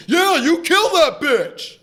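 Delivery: loud, high, drawn-out movie heckle; slow whooping shout at the screen; heckler's yell. Retold or imitated. Imitates heckler behind him in theater.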